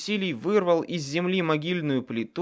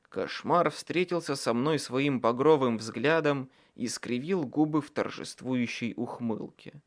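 usual speaking voice

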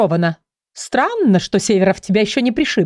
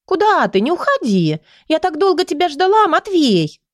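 put-on pleading girl; 9 semitones higher than usual. A narrator's reading. Girl begs him to stay.

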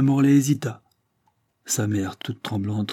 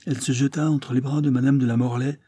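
ordinary delivery, nothing marked

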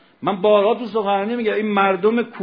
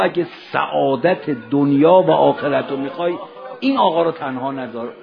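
loud, measured urging; emphatic charge; 3 semitones lower, 7 dB louder than usual. Acted. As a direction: press it home firmly, lower and louder.

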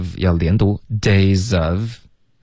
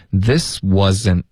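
ordinary delivery

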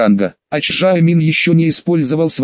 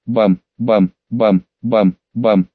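deep booming; 6 semitones lower, much slower than usual. A narrator's reading.

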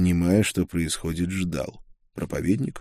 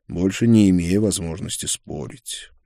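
usual speaking voice

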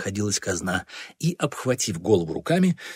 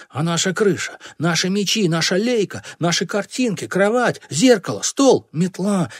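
high, mounting list of losses; outraged lament; 8 semitones higher, 4 dB louder than usual. A narrator's reading. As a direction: wail each loss, building louder to the end. Boys crying out over lost treasures. (горестные возмущённые выкрики)

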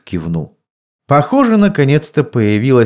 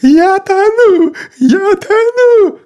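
panicked high cry; 5 dB louder, slower than usual. A narrator's reading. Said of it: "кричала Люси из-под стола".

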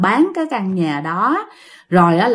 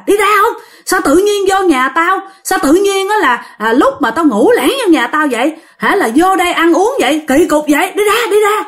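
angry shouting; 8 semitones higher than usual. Retold or imitated. Old woman shouting at intruders to get out, angry. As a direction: chase them out of your house, shouting.

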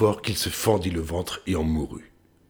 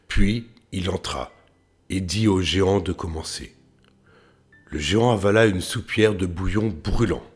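usual speaking voice

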